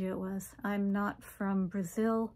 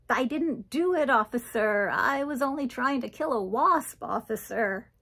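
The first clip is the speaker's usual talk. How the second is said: high, loud plea of innocence; raised-pitch protest, dropping on the last word; protesting suspect; 3 semitones higher, 4 dB louder than usual. Acted. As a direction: plead your case to the police officer. Pleading with cops in retold story.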